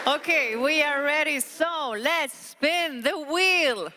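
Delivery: hyped giveaway announcement. Acted. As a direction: stretch it out like a game show host. Host spinning the prize wheel.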